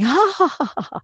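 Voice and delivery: high, spelled-out mock laugh; hammed-up, high "ha ha" laughter; fake flattering laugh. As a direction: fake a laugh to charm someone. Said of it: "Laughing at every little thing".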